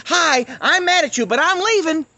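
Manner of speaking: very high, loud caricature; hammed-up high-pitched mimicry; mock angry goodbye. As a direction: announce a huff like a silly attention-seeker. Mimicking people announcing an angry exit.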